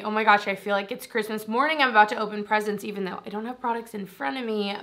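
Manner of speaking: normal talking voice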